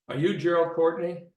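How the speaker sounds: loud announcement, lightly imitating a PA; imitated PA call. Policeman calling a boy from his cruiser, imitated PA call. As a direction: call out as if over a loudspeaker. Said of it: "heard something like a P A".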